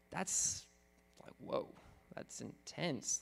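softly stunned at the verse; awed hush. Real, not acted. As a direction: drop to a near whisper, amazed.

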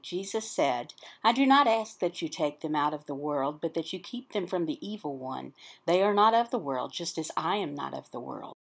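ordinary delivery

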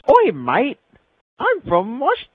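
squeaky bad Australian accent; mock Australian accent. Friend attempts an Australian accent, high and loud. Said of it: "London to Australian".

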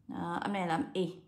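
ordinary talk

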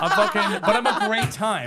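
high laughing voice; squeaky laugh through the words; cracking up mid-joke. Real, not acted.

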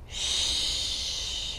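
long, soft shush; whispered shushing. A narrator's reading. Hedgehog hushing Snake, whispering. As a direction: hush someone with breath alone. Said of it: "Hedgehog whispered".

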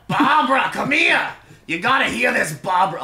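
put-on high, loud calling old lady.

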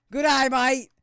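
put-on shrieked Aussie accent, high and loud; Australian yell. Streamer yells Australian meme phrase at chat. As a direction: bellow it like a mock Aussie.

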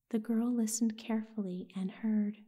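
plain reading voice

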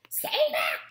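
high, loud panicked squawk; squawking goose cry. Acted. Startled gosling squawks at the robot rushing him.

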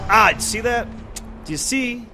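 exasperated high ask. Real, not acted. Showing viewers the annoying enemy.

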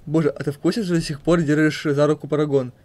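ordinary delivery

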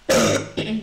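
harsh chesty cough. Real, not acted. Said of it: "I'm really sorry for coughing".

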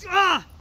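sudden high yelp of pain; pained scream. Acted. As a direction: cry out as you're stabbed. Lord is suddenly attacked.

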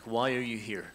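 normal talking voice

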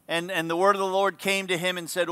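ordinary talk

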